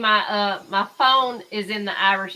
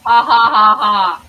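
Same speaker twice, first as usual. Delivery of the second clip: loud laugh; 12 dB louder, slower than usual. Real, not acted. Streamer cackles at court clip.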